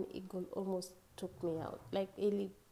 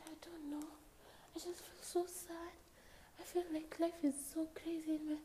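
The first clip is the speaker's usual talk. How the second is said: tearful quote; 9 semitones higher, 3 dB quieter than usual. Retold or imitated. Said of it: "I said".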